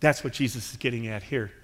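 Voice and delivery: normal talking voice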